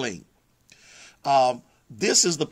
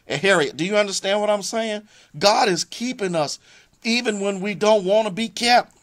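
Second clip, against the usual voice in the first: preaching; 6 semitones higher than usual. Acted. Preaching peak to viewers.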